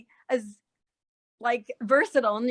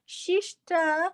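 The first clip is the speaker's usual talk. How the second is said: "Shishita" slow and higher, with sh for s, in a Japanese accent; 6 semitones higher, slower than usual. Shown as a demonstration. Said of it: "like sister, they go".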